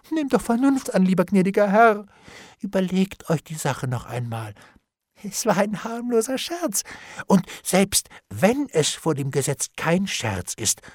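plaintive, mostly high wheedling voice; pleading bailiff. A narrator's reading. Bailiff begs Hendon to see reason, wheedling.

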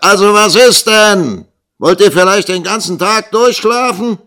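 high, loud schoolmaster's roar; bellowing. A narrator's reading. Schoolmaster roaring up the stairs at sleeping pupils, bellowing. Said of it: "des Schulmeisters Stimme die Treppe heraufbrüllen".

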